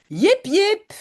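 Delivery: a mild call-out, voice lifted high. Acted. Mother searching for Jip, calling his name. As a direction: call across a distance, high.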